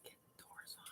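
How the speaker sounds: soft unvoiced whisper on "doors". Real, not acted. Whispering an aside to her helper, hushed. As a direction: breathe the words with no voice.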